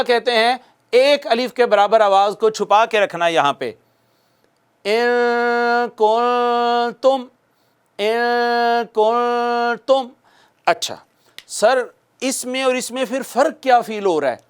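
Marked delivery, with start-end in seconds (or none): 4.8-10.1 stretched nasal ghunna on ان; nasal ghunna demo. Shown as a demonstration. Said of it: "پہلے غنہ کی آواز نکالوں گا".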